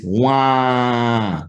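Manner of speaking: wah with mouth open, sound through the nose; nasal vowel. Shown as a demonstration. Teacher showing the Jamaican nasal vowel, nasal wah. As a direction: push the wah up into your nose. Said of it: "The mouth stays open ... in your nose".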